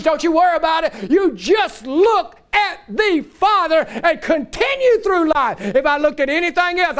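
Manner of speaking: rising sermon delivery; a pastor lifting his voice to the congregation; preaching. Acted. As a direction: preach it with building fervor. Preaching at full force.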